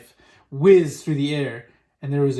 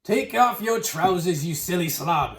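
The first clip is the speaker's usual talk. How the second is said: slow, slightly raised call; mild shout; 2 semitones higher than usual. A narrator's reading. Onlooker orders the cook to undress, mildly shouted. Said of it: "someone else shouted".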